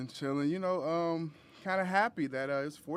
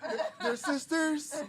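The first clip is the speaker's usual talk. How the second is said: laughing; 12 semitones higher, 9 dB louder, much slower than usual. Real, not acted. Teasing the matching red outfits.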